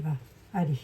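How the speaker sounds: ordinary talk, nothing marked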